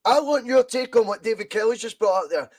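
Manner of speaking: ordinary talk, nothing marked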